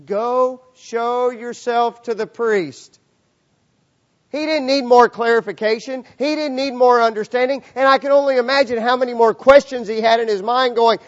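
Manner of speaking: loud, high-pitched pulpit proclamation; full-force preaching. Acted. Preacher telling the church of instant obedience, full-force preaching.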